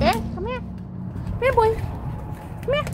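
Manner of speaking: high, soft coaxing call; high sweet call to the puppy. Real, not acted. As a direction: coax the dog over gently.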